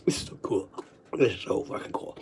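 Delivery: very soft and low, barely voiced; drained murmur after a good cry; spent awe. Real, not acted. Spent after a crying fit.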